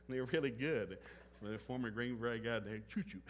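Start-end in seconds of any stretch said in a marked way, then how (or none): none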